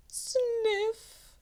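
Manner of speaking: high sniffle; mock sniff. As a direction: sniff like a sad sheep. Sheep sniffling.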